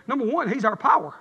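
ordinary talk, nothing marked

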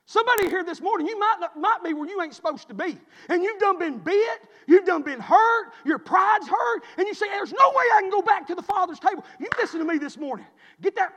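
fired-up pulpit shouting; full-force preaching. Real, not acted. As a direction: drive it home to the congregation, loudly.

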